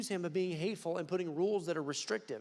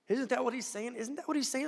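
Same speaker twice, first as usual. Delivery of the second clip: impassioned; 10 semitones higher than usual. Real, not acted. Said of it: "impassioned repeat".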